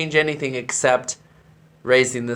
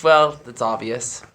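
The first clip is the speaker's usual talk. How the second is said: usual speaking voice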